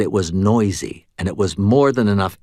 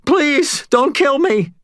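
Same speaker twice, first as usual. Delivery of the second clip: begging; 4 dB louder, slower than usual. A narrator's reading. Cashier begs.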